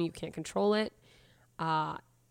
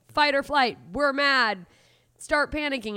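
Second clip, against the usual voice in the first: higher and louder mock alarm, as if the body yells; 7 semitones higher, 6 dB louder, slower than usual. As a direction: sound the alarm like a panicking body. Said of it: "your body is like".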